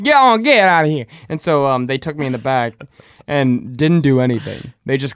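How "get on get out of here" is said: high, loud shoo-away; mock shooing. Staff shooing the stoned kid away, loud and high. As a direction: chase him off like a stray.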